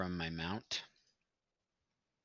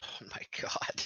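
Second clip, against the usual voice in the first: breathless whisper of disbelief; whispered dismay; 6 dB quieter than usual. Real, not acted. Bad luck on dice.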